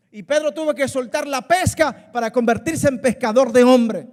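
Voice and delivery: loud, rising pulpit delivery; full-force preaching. Acted. Pastor urging the congregation to let go, full-force preaching.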